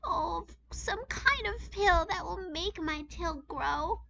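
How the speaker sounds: high, pleading whimper; pleading girl bird. A narrator's reading. Gertrude begging her uncle for a pill, high whining plea. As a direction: beg your uncle with a whine. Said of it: "she cried".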